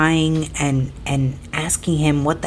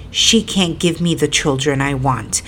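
normal talking voice

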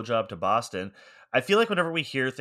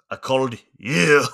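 movie line impression; 3 semitones higher, 6 dB louder, slower than usual.

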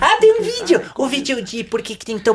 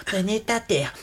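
low, soft practice run; quiet rehearsal; 6 semitones lower, 9 dB quieter than usual. Real, not acted. Singer rehearsing to self before performing, low and soft. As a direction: try it out under the breath, low. Trying words out before singing.

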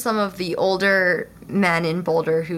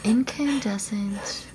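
slow breathy whisper of the word; 5 dB quieter, slower than usual. Shown as a demonstration. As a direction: whisper it slowly, savoring it. Repeating the word dramatically.

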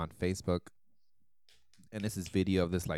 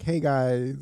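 sheepish greeting; 4 semitones higher, 5 dB louder than usual. Retold or imitated. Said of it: "woke up the next morning like".

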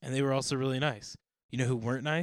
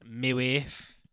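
put-on Borat accent; 5 dB louder, slower than usual. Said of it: "Borat impersonation".

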